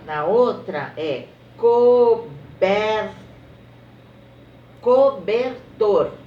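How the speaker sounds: teacher naming pictures slowly; slow syllable reading. Shown as a demonstration. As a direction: read the words slowly for kids. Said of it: "naming pictures slowly".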